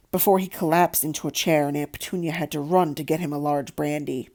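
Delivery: plain reading voice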